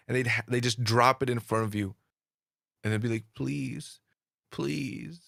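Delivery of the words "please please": soft, small begging voice; hushed, drawn-out plea; imitated pleading. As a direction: softly plead like a child begging.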